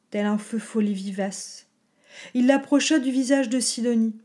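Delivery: ordinary delivery, nothing marked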